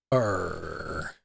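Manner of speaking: long, low, quiet pirate growl. Pretend. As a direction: let a soft pirate "arr" drag out. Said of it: "give him a pirate eye patch".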